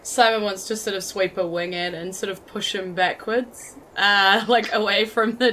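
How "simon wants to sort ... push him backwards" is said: ordinary delivery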